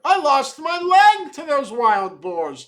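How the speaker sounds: high, loud protest; chiming in loudly. A narrator's reading. Third man joining the others' protest, chiming in loudly. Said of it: "chimed a third".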